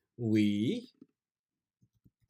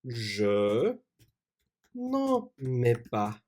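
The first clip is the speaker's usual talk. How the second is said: slow dictation; much slower than usual. Shown as a demonstration.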